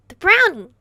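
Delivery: high, loud panicked hiss; frantic hiss. Acted. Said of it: "He hissed at me frantically".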